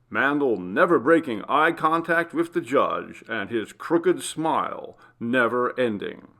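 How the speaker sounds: crisp TV news anchor read; news report. Acted. Reporter closing a crime story for the evening news, anchor read. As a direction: deliver it like a TV reporter on air.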